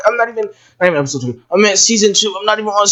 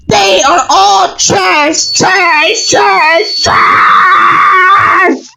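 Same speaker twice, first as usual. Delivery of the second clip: high, loud, slow repeated shout of trash; full-throated rant against the designs; ranting yell; 10 semitones higher, 3 dB louder, much slower than usual. Real, not acted. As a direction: hammer each trash louder. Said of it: "I can't hold it in anymore".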